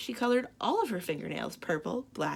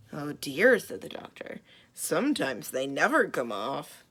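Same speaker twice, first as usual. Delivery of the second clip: a hint of sober concern; grave doctor; 6 semitones lower, 3 dB louder than usual. Doctor answering the frantic mother, lower and grave.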